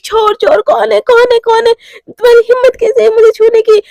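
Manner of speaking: startled, high and loud shouting; frightened shout. Acted. Bride shouting at unknown intruder, panicked loud yell. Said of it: "ऐसे बोल के ना वो चिल्लाने लगती है एकदम बहुत ज़ोर-ज़ोर से".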